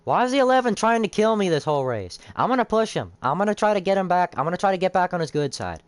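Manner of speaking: high, worked-up frustration; rising exasperation at the wrecker; frustrated excitement. Real, not acted. Frustrated while being wrecked in race.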